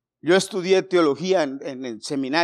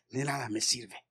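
hushed; 5 semitones lower, 10 dB quieter than usual. Acted. Pastor hushing the refrain to the church, hushed. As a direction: drop to a hushed, much softer refrain. Refrain dropped to near whisper.

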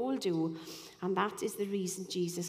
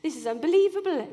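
rising, louder, faster amazement; disbelief; 4 semitones higher, 5 dB louder than usual. Retold or imitated. She reacts to the queue row in loud disbelief. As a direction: exclaim in disbelief. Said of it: "I'm thinking".

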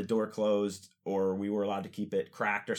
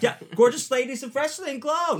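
put-on much higher, louder kid voice; whiny kid; 6 dB louder than usual. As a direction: sell it to mom like a whiny kid. Kid explains show to mom.

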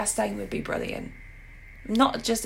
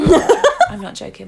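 laughing; 6 semitones higher, 10 dB louder, slower than usual. Real, not acted. Joking about being cast herself.

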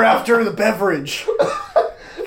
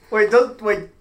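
ordinary delivery

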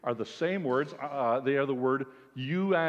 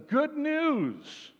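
a sudden leap up in pitch on "Good news"; exclaiming good news; 2 semitones higher, slower than usual. Retold or imitated. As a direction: announce it as joyful surprise. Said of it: "Mark and he says".

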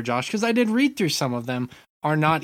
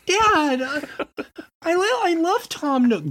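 put-on high little kid voice; 10 semitones higher than usual. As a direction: plead like a small child, higher. Kid voice again, much higher.

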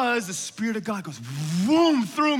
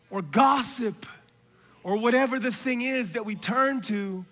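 ordinary talk, nothing marked

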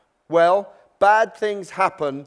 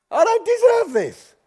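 much higher whining complaint; whiny self-pity; 3 dB louder than usual. Acted. Aggrieved believer pleading to God, high and whiny. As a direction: whine it like a wounded victim.

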